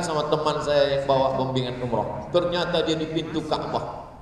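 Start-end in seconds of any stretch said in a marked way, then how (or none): none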